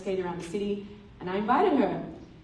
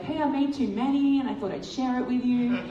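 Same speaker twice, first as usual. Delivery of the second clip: rehearsed friendly offer; 5 semitones higher, 4 dB louder than usual. Pretend. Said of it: "literally role-playing this in my mind".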